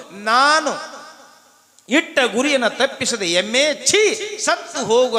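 high, indignant scolding of a culprit; acted flare of boyish temper; angry boy's curse. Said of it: "ಸಿದ್ಧನಿಗೆ ಸಿಟ್ಟು ಬಂತು".

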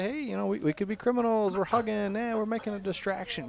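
high, softened mock-innocent voice; put-on high chatter of fake criminals; pretend criminals. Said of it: "they're trying to say".

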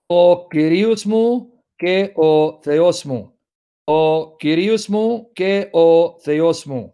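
slow "O Kyrios mu ke o Theos mu" with Greek vowels; Greek pronunciation. Shown as a demonstration. Reading John's Greek to students in careful Greek pronunciation. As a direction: draw out "Kyrios" the Greek way, word by word. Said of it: "Trying to pronounce like Greeks would".

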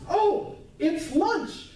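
high, slow, gleeful lion voice with a laugh; hungry lion. Retold or imitated. Preacher voices the lion seeing the girl, gleeful. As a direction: play the lion spotting its lunch. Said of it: "the lion sees her and says".

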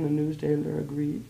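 ordinary delivery

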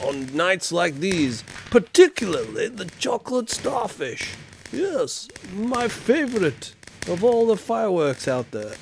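guessing how Guy Fawkes talked, mock olde English; old English accent. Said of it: "What do you think he talked like?".

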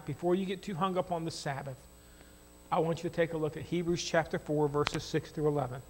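ordinary talk, nothing marked

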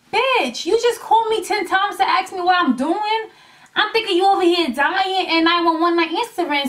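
high-pitched exasperated disbelief; exasperated scolding. Retold or imitated. Venting at a friend who called ten times, exasperated and high. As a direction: throw it back at her, outraged she called ten times.

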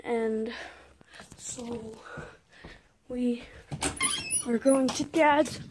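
usual speaking voice

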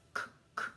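k, k said as soft voiceless clicks, no vowel; the k sound. Shown as a demonstration. Teacher showing the sound C does not make, a whispered k. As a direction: tap out the bare k sound twice. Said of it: "no suena k".

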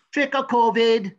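loud, high, drawn-out door-to-door call. Acted. Professor mimicking kids at the door, loud high call. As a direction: call it out slowly in a German accent.

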